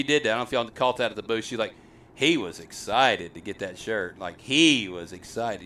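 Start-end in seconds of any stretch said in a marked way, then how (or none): 2.2-4.2 HE leaned on, slow and deliberate; stress on HE. Retold or imitated. Parent correcting host about the boy, stress on HE. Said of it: "stress the fact that this is a boy". 4.4-5.6 slow, pointed emphasis on HE; single out "he" and draw it out; stress on HE. Retold or imitated. Retelling parent stressing it's a boy, stress on HE. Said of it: "stress the fact that this is a boy".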